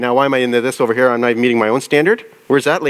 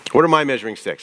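ordinary talk

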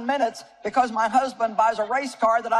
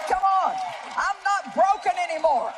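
preaching at full force; 5 semitones higher than usual. Real, not acted. Preacher proclaiming healing to the congregation, fervent. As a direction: lift the room with the promise.

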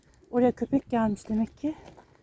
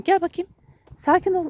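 loud higher command; real shout; 7 semitones higher, 6 dB louder than usual. Real, not acted. Owner shouting at her dog to calm down, loud command. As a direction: snap it out to stop him. Calming dog after fight.

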